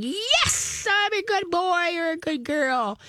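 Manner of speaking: very high, slow, excited squeal; playful dog's inner voice, high and giddy; excited dog's thoughts. Retold or imitated. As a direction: voice the overexcited dog in a high squeaky tone.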